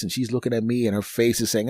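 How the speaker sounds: ordinary delivery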